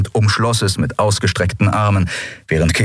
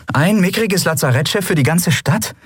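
disbelieving; 11 semitones higher than usual. Acted. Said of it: "Endril konnte es nicht glauben".

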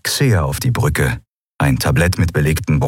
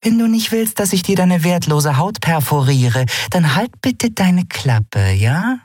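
high-pitched, patiently delivered warning; patient threat; 12 semitones higher than usual. A narrator's reading. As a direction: threaten him patiently, slowing toward the end. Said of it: "sagte Nelen geduldig".

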